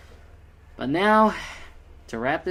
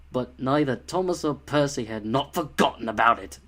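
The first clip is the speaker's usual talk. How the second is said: hammed-up narrator punching NOT and FORGOTTEN; mock a narrator leaning hard on words like FORGOTTEN; overemphasized narration; 3 semitones lower than usual. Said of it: "you overemphasize a few of the words".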